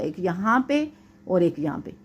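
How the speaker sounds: ordinary delivery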